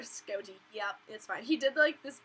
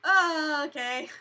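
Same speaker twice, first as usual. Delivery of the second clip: startled oh okay; 5 semitones higher, 10 dB louder, much slower than usual. Retold or imitated. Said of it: "I'm like".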